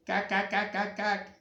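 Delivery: high, quick duckling quacks; string of squeaky little duck calls; baby duck. Acted. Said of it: "of the baby ducks".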